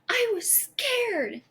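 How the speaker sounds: high, drawn-out mock yell without much volume; imitated child's yell. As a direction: imitate an upset daughter's high-pitched outburst. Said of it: "she yelled at me".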